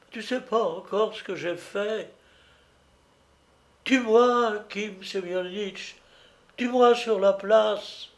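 a guilty wife begging her husband for punishment; despairing wife. Acted. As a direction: beg him to kill you, voice up high and breaking.

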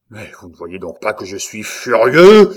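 hurlement furieux, bien plus fort; hurlé. Acted. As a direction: crie ta rage à pleine voix. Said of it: "hurla le comte". (le comte hurle sa fureur au visage de Saint-Luc)

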